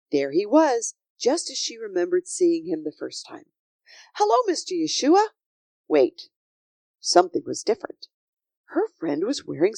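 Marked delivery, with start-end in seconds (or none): 4.1-5.3 put-on high, quick little-girl hello; delighted greeting. A narrator's reading. Sophie sees her friend return, greeting him eagerly.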